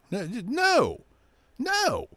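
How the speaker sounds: high squeaky no, no; lightly voiced flustered whine; mock high protest. Mocking himself to viewers, squeaky no.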